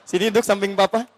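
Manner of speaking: usual speaking voice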